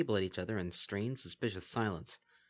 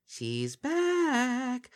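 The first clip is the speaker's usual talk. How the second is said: high lilting sing-song; 4 dB louder than usual. A narrator's reading. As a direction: sing it out teasingly. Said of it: "in a lilting sing-song voice".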